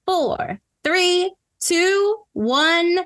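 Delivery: rising, building countdown; host's suspenseful countdown; game countdown. Real, not acted.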